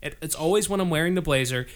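normal talking voice

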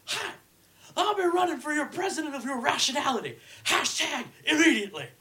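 high, loud announcer impression; Harry Carey voice. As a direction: bellow it like a ballpark announcer. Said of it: "read one in the Harry Carey voice".

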